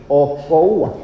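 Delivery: "okoua" said slowly, each vowel clear; "okoua" spoken carefully as a Hebrew word. Shown as a demonstration. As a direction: spell out "okoua" by its sounds. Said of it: "se escribe así".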